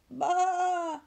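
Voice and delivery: high, loud sheep bleat in alarm. Acted. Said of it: "Daisy bleated in alarm".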